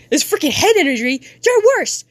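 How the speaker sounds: high, heated acted jab; angry insult. Kid insulting parent, angry high outburst. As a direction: let the pitch leap as you lash out.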